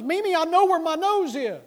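put-on high, loud protesting kid voice; kid's loud, high-pitched retort; indignant child. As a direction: imitate an exasperated little boy calling out loudly. Voicing indignant small child in story.

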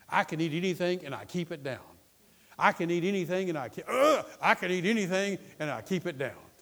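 forceful faith confession; defiant declaration. Acted. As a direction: declare it stubbornly even through a retch. Acts defiant confession after vomiting.

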